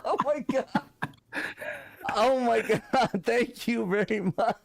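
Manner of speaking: high, laughing, overwhelmed thanks; real giddy gratitude; laughing disbelief. Real, not acted. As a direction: laugh through the thanks, can't believe it.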